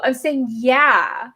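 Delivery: YEAH drawn out slowly at the end; slow, insistent YEAH; correcting emphasis. Retold or imitated. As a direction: lean on YEAH to set it apart from yay. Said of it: "And I'm like, "No, no".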